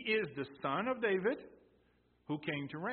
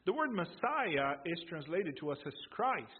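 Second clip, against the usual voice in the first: ordinary talk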